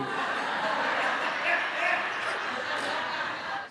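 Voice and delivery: high, airy burst of laughter; laughing at the memory; laughs. Real, not acted. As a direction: let a breathy laugh out. Laughing at the girls.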